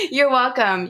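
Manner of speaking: laughing through the thanks; laughing you're welcome. Real, not acted. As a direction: giggle the words out. Laughing thanks after compliment.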